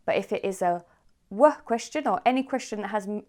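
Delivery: usual speaking voice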